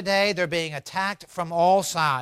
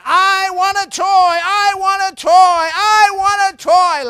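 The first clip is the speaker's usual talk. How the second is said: high, loud chanted tantrum scream; louder and louder child; 12 semitones higher, 10 dB louder than usual. Acted. Daughter jumping up and down at her father, screaming louder. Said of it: "Louder and louder she gets".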